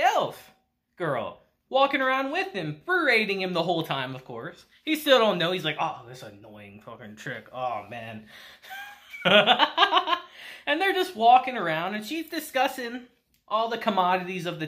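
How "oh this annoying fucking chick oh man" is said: low, much softer grumbling under the breath; an irritated aside, much quieter; muttered grumble. Retold or imitated. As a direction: mutter it to yourself, annoyed. Uncle grumbles about the elf.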